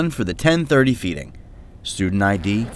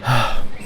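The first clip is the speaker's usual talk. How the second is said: soft heave. Acted. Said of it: "He heaved softly".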